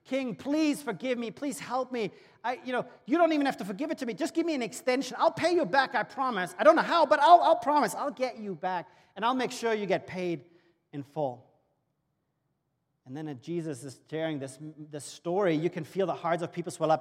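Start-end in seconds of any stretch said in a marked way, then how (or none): 0.0-11.5 high, desperate begging for mercy; a servant pleading with the king, high and desperate. Acted. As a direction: beg the king for your life. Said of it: "begs for his life and says".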